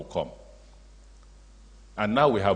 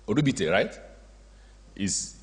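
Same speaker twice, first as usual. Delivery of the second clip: ordinary talk, nothing marked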